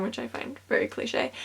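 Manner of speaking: a wry little whispered comment under the breath; whispered aside. Real, not acted. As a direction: whisper it dryly, as a private joke to the camera. Wry aside about broken case.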